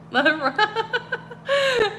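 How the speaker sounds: bright burst of giggling at her own slip; high embarrassed laugh. Real, not acted. Host laughing after fumbling a word on stream.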